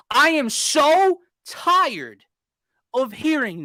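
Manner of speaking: each word drawn out slowly; slow emphatic. Real, not acted. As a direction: lean hard on every word. Venting about coach excuses.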